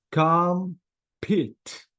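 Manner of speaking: "Compete" split as com, peet; two syllables. Shown as a demonstration. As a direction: pause after com in "Compete". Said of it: "two syllables".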